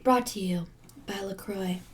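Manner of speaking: whispered fake sponsor plug; tongue-in-cheek whispered plug; whispered mock ad. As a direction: hush it like a secret commercial. Mock sponsor line after sipping.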